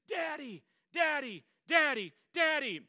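high, very loud acted cries for help; screaming for daddy. Little girl chased by a dog calls her father, screaming. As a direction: yell it out like a frightened little girl. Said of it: "at the top of her lungs".

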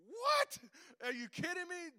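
hammed-up disbelief; mock shock. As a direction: squeak out the price shock. Retold shock at wig price.

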